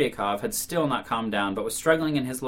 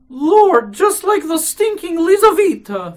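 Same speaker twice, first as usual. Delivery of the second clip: put-on frightened old woman; 12 dB louder, slower than usual. A narrator's reading. Marfa's frightened thought, voiced.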